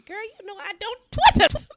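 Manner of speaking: high, loud comic protest; playful protest. Real, not acted. Friend told to tweet Adidas, playful protest. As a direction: push back loudly, landing hard on "Twitter".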